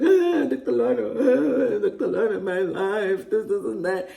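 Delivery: high, half-laughing whine to the doctor; hammed-up tearful complaint; imitating her younger self crying to the doctor; mock crying plea. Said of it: "and I was like".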